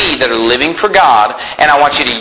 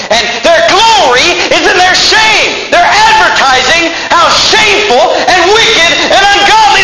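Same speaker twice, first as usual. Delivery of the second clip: high, ringing pulpit shout; full-force preaching; 11 semitones higher than usual. Real, not acted. Preacher condemns Hollywood's shame to his congregation, shouting. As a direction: drive each phrase out in sustained indignation.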